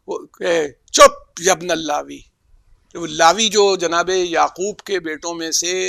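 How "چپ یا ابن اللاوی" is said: loud sharp rebuke; angry hush. Retold or imitated. Moses rebuking Qarun, loud angry hush. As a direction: silence him angrily. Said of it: "جناب موسیٰ غصے میں یہ کہتے تھے".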